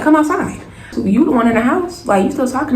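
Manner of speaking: high-pitched retold dare; confrontational. Woman daring the sister to come outside, confrontational. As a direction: call her out, mock-combative.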